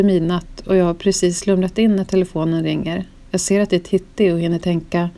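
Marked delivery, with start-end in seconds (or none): none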